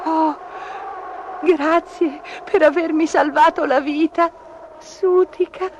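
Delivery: high, tearful kitten voice; small sobbing storybook kitten; sobbing thanks. A narrator's reading. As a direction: thank through acted sobs. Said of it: "singhiozzò Gobbolino".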